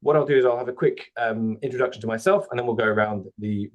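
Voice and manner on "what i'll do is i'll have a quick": ordinary delivery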